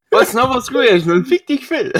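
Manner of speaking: laughing. Retold or imitated. Repeating odd name, laughing.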